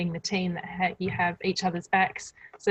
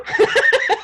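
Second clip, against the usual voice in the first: high laugh; 11 dB louder than usual. Real, not acted. Laughing at own joke.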